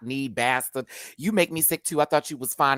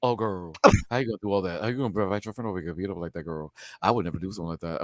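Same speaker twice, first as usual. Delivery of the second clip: gossipy imitation; 5 semitones lower, 3 dB quieter, much faster than usual. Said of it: "like he said".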